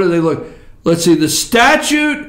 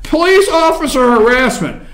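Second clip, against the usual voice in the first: normal talking voice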